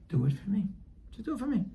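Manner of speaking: high, gentle coaxing; soft pleading. Acted. God asking us for a favor like a father, pleading.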